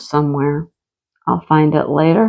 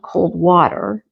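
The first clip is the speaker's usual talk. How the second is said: usual speaking voice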